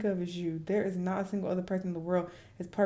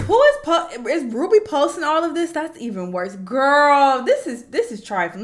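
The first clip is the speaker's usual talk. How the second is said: much higher, loud disbelief; shocked outburst; 8 semitones higher, 13 dB louder than usual. Real, not acted. Shocked that Ruby posts this, loud and high. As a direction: yell it in shock.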